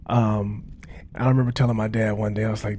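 normal talking voice

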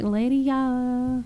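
"Lydia" stretched with extra syllables; drawn-out Lydia. Retold or imitated. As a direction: pull "Lydia" long like mom does. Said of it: "she pronounces everyone's name".

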